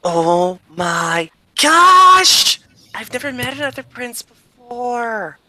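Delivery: high, drawn-out delighted squeal; squeal, then gush in a high girlish princess voice. Acted. Princess thrilled to meet another prince, gushing squeal.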